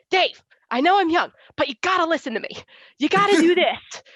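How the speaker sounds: put-on teen coaching a veteran, playfully bossy; bossy young self. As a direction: lecture an older man like a know-it-all kid. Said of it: "he would come to practice and be like".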